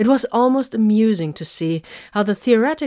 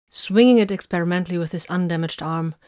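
usual speaking voice

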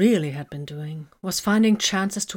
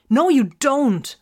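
high, loud, slow wail; 9 semitones higher, 7 dB louder, slower than usual. A narrator's reading. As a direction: cry the words out in despair. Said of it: "Vox wailed".

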